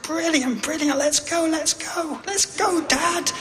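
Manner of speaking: put-on very high, eager little kid; excited child voice. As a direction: squeal it like an impatient child. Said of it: "And I was like".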